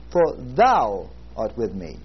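"thou" drawn out and leaned on, the rest dropping away; slowly, weight on "thou", then let "art with me" fall; stress on thou. Shown as a demonstration. Preacher showing how to read the Psalm, stress on "thou". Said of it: "emphasize a word at a time".

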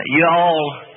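slow, drawn-out hill country "you all"; a hint of hill country dialect; hill country speech. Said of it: "that's their way of speaking".

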